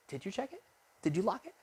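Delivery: high, hushed nagging questions; nagging inner voice. Retold or imitated. Inner voice doubting a locked building, hushed nagging.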